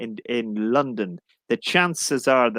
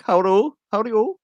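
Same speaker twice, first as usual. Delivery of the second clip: high, sing-song Cork greeting; Cork accent; 9 semitones higher than usual. Shown as a demonstration. Teacher showing Irish dialects with a greeting, high Cork accent. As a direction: pitch it well up in a Cork accent. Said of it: "And Cork".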